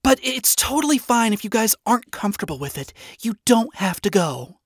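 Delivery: high, gentle character voice; high-voiced, accommodating princess; reassuring princess. A narrator's reading. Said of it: "The princess clarified to her beloved friends".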